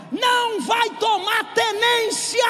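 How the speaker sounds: slow, high, blunt shout. Acted. Pastor speaking bluntly to the straying, slow shout. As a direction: lay it out plainly and hard. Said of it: "Vou falar bem rasgado".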